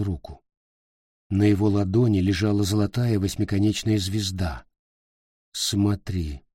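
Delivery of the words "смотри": lifeless quiet. Acted. Despairing Peter.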